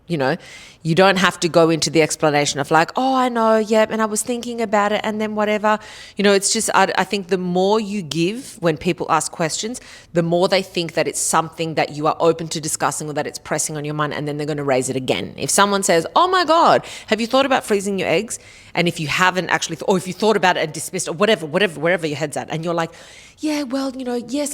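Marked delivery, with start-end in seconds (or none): 2.9-5.8 higher-pitched chatty waffle; mock people-pleasing voice; mimicked over-explaining. Mimics over-explaining to nosy askers. 16.1-18.4 high, gushing mock friend; mock excited suggestion; gushing nosy question. Voices nosy person asking. 23.4-24.5 high, softer waffling reply; imitated polite hedging; mock hesitant agreement.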